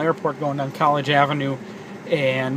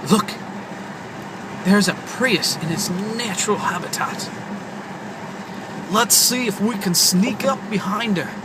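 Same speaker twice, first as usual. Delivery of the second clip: nature documentary; 3 semitones higher than usual. Pretend. Mock nature documentary about a Prius.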